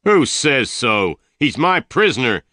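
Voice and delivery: loud, high angry challenge; shouting. A narrator's reading. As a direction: shout down the man defying you. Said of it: "Tull shouted".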